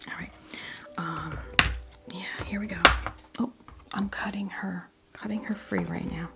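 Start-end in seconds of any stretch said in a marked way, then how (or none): none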